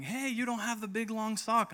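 a hint of a bright friend calling out; quoted friend. A friend this morning calling out, mildly voiced.

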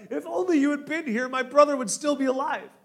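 high, loud anguished plea; crying out in grief. Acted. Mary crying out to Jesus in grief, anguished. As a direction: wail it at his feet. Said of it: "she cries out".

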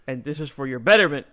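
loud, high, pushy preaching tone; preachy do-gooder voice. Retold or imitated. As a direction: boss them for their own good. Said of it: "it's like the good bad guy".

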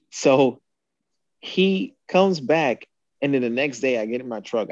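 usual speaking voice

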